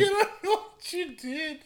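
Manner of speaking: high laughing echo. Real, not acted. Echoing friend, laughing.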